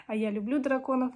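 ordinary talk

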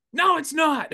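high, loud denial; mock shout. The guide denying it to the surgeon, a high loud mock shout. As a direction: yell it in disbelief. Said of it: "The surgeon, I'm like".

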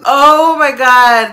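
slow, loud "Oh my God"; loud stretched fangirl cry; excited gasp. Real, not acted. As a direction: draw it out in awe. Reacting to members' looks.

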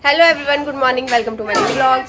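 normal talking voice

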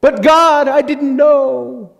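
high, slow pleading whine aimed at God; mock whining excuse. Sinners pleading ignorance to God, high mock whine. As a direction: whine it like a caught child begging God. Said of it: "And they say".

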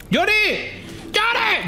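high call to a teammate. Real, not acted. Player calling his teammate Johnny, high urgent call. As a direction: shout the name up high.

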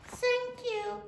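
high-pitched little thanks; grateful little kid goat. A narrator's reading. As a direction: thank the sheep in a tiny goat voice.

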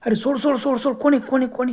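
high, frantic cries of let go; panicked yelling. A narrator's reading. Dragged men crying out in fear, high and frantic. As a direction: scream in terror as unseen hands drag you. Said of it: "दोघं एकदम ओरडायला लागले".

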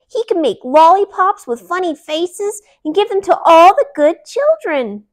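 put-on high, loud childish voice; a greedy little kid dreaming of candy; little cousin. Hungry little cousin wishing for a candy store, high and loud.

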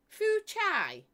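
"Fu Chai" as foo CHYE, high and loud; saying Fu Chai. Shown as a demonstration. Teaching viewers the brand name, up high. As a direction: sound out "Fu Chai" to rhyme with Tai Chi. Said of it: "As in Tai Chi".

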